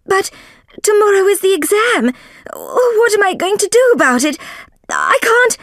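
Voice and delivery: very high, loud and fretful; rising panic about the exam; panicked. Acted. Soames frets to Holmes about tomorrow's exam, panicked. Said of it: "Mister Soames cried".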